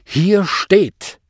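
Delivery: high, loud, tense reading; barely controlled anger. A narrator's reading. Superior reads the report to Zott, barely containing anger. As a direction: read it out holding back rage. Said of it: "seinen Zorn nur schwer unter Kontrolle".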